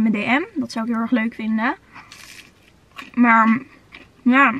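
speaking through a bite; chewing while talking; mouth full of food. Real, not acted. Seen 0: Holds nugget throughout; bites it and speaks with food at mouth from 3.1s.

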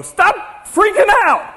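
high, loud, drawn-out shout; exasperated yell. Acted. Preacher as Jesus calming terrified disciples, exasperated yell. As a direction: holler it over a panicking room. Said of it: "I would have to go".

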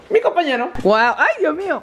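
loud, delighted fuss over the dogs; excited dog greeting. Real, not acted. (saludo emocionado y fuerte a los perros)

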